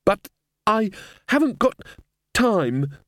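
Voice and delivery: high plaintive wail; wailing girl. Acted. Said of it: "Susan wailed".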